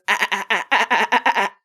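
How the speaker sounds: staccato run of "ah" bursts; imitated pulsating cry. Storyteller imitates Omar closing his eyes and pulsating, staccato cries. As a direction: mimic a healer's rapid, pulsing cries. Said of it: "looks up to the sky and he goes".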